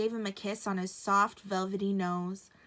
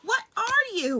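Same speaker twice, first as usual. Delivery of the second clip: much higher, louder creature voice; wild rabbit asking; 13 semitones higher, 6 dB louder than usual. A narrator's reading. Wild rabbit questioning the stranger, very high. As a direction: squeak out the question. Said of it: "one of them asked".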